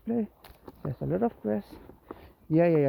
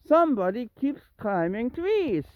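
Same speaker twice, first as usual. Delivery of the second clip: higher, sing-song exasperated complaint; mock exasperation; 6 semitones higher, 4 dB louder than usual. Real, not acted. Owner grumbling about a tree-climbing cat, exasperated. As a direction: complain pointedly about the culprit.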